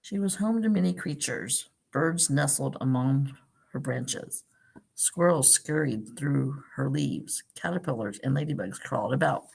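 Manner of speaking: gentle storybook read-aloud; picture-book narration for a class; reading to children. Acted. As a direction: read it like story time for little kids.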